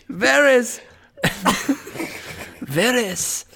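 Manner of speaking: hammed-up Spanish soap narration; put-on dramatic Spanish accent; sexy Spanish accent. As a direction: announce it with telenovela gravity in a Spanish accent. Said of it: "My sexy Spanish accent".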